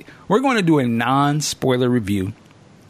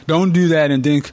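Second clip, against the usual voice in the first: ordinary delivery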